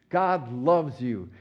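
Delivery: slow, gentle and sincere, stretching God loves you. Shown as a demonstration. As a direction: say God loves you slowly, meaning every word. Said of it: "It just say it sincerely".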